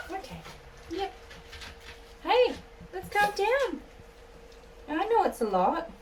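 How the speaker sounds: high cooing pet voice, praising then calming. Real, not acted. Calming dog during grooming.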